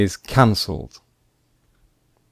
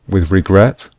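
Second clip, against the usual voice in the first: normal talking voice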